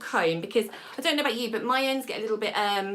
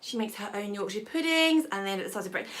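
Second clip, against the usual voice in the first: normal talking voice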